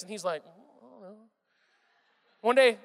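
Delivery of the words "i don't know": put-on guilty toddler mumble. Toddler caught stealing vitamins answers.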